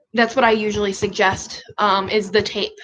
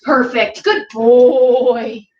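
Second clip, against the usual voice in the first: dog praise; 5 dB louder, much slower than usual. Real, not acted.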